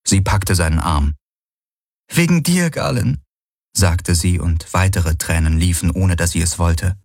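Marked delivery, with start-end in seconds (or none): 2.1-3.2 tearful. Acted. Kirai blaming Galen while crying, tearful. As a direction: say it through tears.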